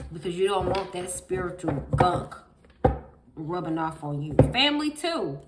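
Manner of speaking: loud, fervent sermon to the congregation; preaching. Real, not acted. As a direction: preach it with full force. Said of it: "I'm finna get on here and preach".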